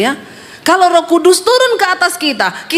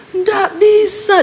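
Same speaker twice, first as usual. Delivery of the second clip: high, very slow strained refusal; mouth held shut; 6 semitones higher, much slower than usual. Retold or imitated. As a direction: act a possessed man whose mouth is held. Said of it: "ditahan sama iblis mulutnya". (tiruan suara tertahan, tinggi dan diseret)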